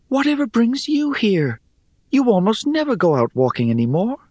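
high-pitched, surprised questioning; high character voice, quickening with surprise; Rhea, surprised. A narrator's reading. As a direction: greet an unexpected visitor in a high voice. Rhea questions her surprise visitor.

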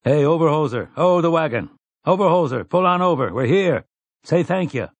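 high, hailing shout. A narrator's reading. Gunslinger hails a man by the wagon, shouting. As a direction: call out to someone across a distance. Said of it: "raised his voice and shouted".

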